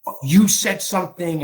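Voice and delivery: pointed mock reproach; blaming non-apology. Shown as a demonstration. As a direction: demonstrate shifting the blame. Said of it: "I don't say".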